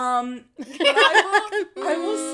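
slow, laughing through words. Real, not acted. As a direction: chuckle while saying it. Laughing through a jokey concession.

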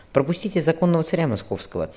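high falsetto voice; a young man squeezing into falsetto. A narrator's reading. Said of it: "фальцетом сказал Фёдор Борисович".

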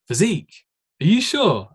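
imitated doubtful reaction, pitch jumping up; acted-out incredulous query; mock disbelief. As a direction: question it like a baffled stranger. Acts out people's disbelieving reaction.

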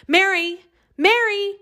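high calling out a name. Acted. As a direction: call her name through the door. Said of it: "she called".

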